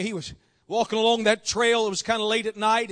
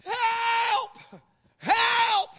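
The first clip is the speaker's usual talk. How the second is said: high, stretched mock cry for help; acted yell for help; 11 semitones higher than usual. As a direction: call out like a man dangling off a cliff. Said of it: "he yells out".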